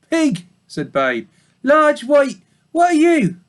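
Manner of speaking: high, loud piglet voice; young pig. Acted. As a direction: answer proudly as a little pig. Babe's voice.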